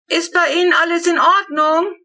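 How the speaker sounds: high, loud call across the garden; calling over. A narrator's reading. Father calling over to the neighbours at night, loud call. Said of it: "ruft er hinüber".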